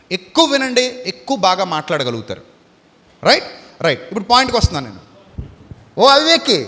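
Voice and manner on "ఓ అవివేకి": rebuking call. Retold or imitated. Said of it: "ఆయన కూడా ఏమన్నాడు".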